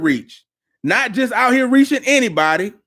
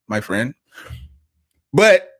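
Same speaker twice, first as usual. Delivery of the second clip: normal talking voice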